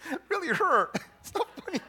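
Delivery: real laughter over the words; laughing through pain. Real, not acted. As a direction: wince and laugh at once. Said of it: "Trying not to scream from pain".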